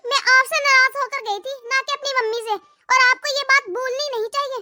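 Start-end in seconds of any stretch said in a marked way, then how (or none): none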